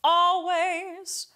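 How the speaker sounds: "Always" hit with a hard glottal catch, sung high and slow; "Always" with a hard glottal attack. Shown as a demonstration. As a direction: slam the vowel of "Always" from the throat. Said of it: "This can be a hard glottal attack".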